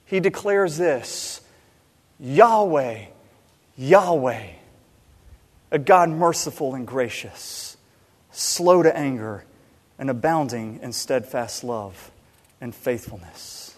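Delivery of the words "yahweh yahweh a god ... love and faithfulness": lower, measured, reverent proclamation; God solemnly declaring his own name; solemn divine declaration. Retold or imitated. Quoting God's declaration of his name.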